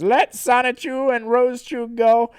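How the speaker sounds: high, loud heroic ultimatum; cartoon hero laying down terms; Sonic. Sonic bargains for the captives, loud and bold. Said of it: "Sonic says".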